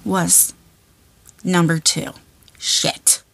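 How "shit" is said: whispered curse. Real, not acted. Finds poop, muttered curse.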